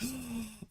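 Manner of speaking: a sharp mock gasp of shock. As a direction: gasp as if you felt the field. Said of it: "will be like".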